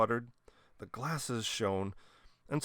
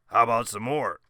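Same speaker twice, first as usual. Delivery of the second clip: hearty; 8 dB louder than usual. A narrator's reading. Whiskers offers more.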